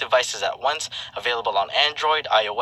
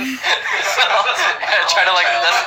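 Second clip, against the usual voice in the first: laughing; 8 semitones higher, 6 dB louder, much slower than usual. Real, not acted. Laughing about Filipino accent.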